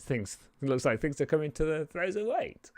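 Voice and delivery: lightly voiced smug prisoner; prisoner figure. Caged figure greeting the arrivals, lightly voiced and sly. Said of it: "G'day, he says".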